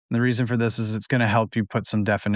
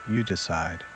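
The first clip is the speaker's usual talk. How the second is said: unhurried, lower and quieter, easing them into relaxation; guided meditation; 3 semitones lower, 7 dB quieter than usual. Acted. Host guides listeners to relax before sleep, guided meditation.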